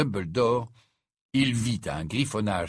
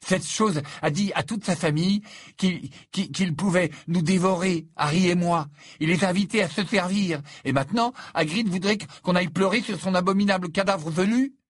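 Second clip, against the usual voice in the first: high, loud, indignant tirade; outraged rant; 10 semitones higher, 5 dB louder than usual. A narrator's reading. Ron raging about mourning a monstrous spider, outraged. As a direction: rant in disbelief, pitched way up.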